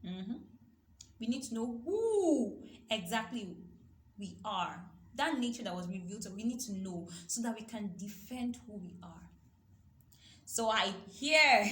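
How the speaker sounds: ordinary talk, nothing marked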